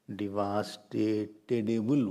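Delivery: drawn-out coined word. Shown as a demonstration. Teacher to class, stretching devastatable out slowly. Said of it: "what English is this?".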